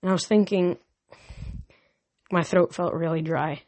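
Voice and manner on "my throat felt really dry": ordinary delivery, nothing marked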